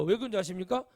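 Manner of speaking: usual speaking voice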